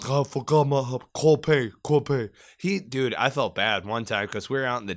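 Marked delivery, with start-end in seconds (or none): 0.1-2.3 put-on Spanglish accent. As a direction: mimic the gunner's slurred Spanglish accent. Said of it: "still probably has that fucking accent".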